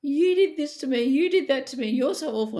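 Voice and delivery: high, loud mock tantrum; hammed-up tearful accusation. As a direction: whine like an upset person throwing a fit. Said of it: "they go off their little trees".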